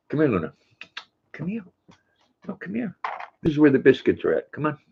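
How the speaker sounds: high, soft sing-song coaxing of a dog. Real, not acted. Owner coaxing her dog Luna onto the chair, high cooing baby talk.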